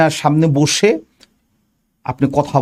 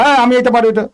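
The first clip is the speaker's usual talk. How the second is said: high, slightly raised over-eager answer; demo of talking loudly; 6 semitones higher, faster than usual. Shown as a demonstration. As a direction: demonstrate answering too forcefully. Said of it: "জোরে জোরে কথা বলেন".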